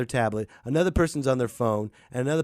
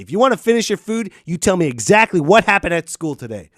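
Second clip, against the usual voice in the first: stern parent; 3 semitones higher, 7 dB louder than usual. Acted. Parent interrogating kid at dinner.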